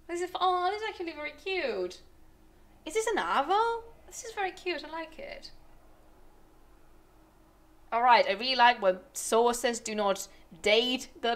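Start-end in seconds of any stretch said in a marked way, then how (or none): 0.1-5.5 cooing. Real, not acted. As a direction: gush over something cute. Cooing over cute picture.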